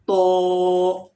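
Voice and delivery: loud, full-mouthed "तो"; heavy toe. Shown as a demonstration. Teacher models the heavy letter for students, loud. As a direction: hit "तो" thick and heavy. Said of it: "तो also heavy sound letter".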